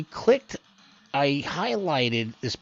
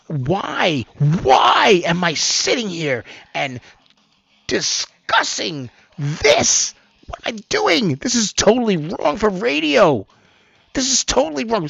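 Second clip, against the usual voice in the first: exasperated; 6 semitones higher, 4 dB louder than usual. Acted. Host voicing a fed-up reader, exasperated. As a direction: demand why, hammed-up exasperation. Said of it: "that's how you feel when you".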